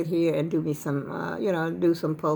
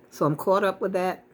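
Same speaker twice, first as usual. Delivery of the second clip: normal talking voice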